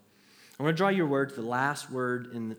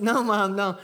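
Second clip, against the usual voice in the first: insisting; 8 semitones higher, 10 dB louder than usual. Retold or imitated. Insisting to mom on phone.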